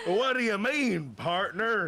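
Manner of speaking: high, loud mock cowboy twang; southern accent. Tourist switching accents on customs, mock southern. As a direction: ham up an American southern accent. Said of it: "a southern accent at one point".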